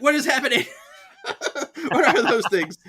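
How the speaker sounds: high, laughing panic at the monsters; panicked squeal. Retold or imitated. Henry seeing the spirits, high laughing panic. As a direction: squeak it out half-laughing, spooked.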